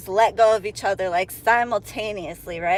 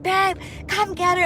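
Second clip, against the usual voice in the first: mock plea; 8 semitones higher than usual. Woman calling her boyfriend mid-fight, whiny plea. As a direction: call your boyfriend for help.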